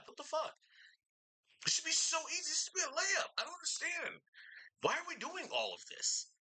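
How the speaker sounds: overdramatic exasperation. Real, not acted. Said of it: "this is getting a little too dramatic".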